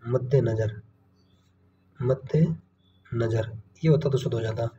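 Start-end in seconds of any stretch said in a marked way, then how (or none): 0.1-0.8 मध्य नजर said as madde nazar; मध्य नजर as madde nazar, dropping in pitch; correct pronunciation. Shown as a demonstration. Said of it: "क्या होता है?". 2.0-3.6 मध्य नजर as madde, pause, nazar; मध्य नजर broken by a pause, first word ending in e; correct pronunciation, split. Shown as a demonstration. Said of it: "क्या होता है?".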